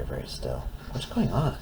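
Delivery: hushed. Acted. Hushed reading to toddler.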